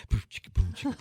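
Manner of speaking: vocal beatboxed drum machine loop; drum machine imitation. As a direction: mimic a simple boom-chick beat. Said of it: "get my drum machine going".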